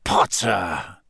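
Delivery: low, snarled name. A narrator's reading. Snape sure Potter is nearby, snarled. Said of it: "Snape snarled".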